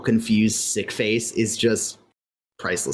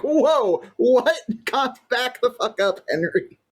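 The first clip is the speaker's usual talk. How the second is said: mock alarmed disbelief; 11 semitones higher, slower than usual. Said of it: "everyone's like".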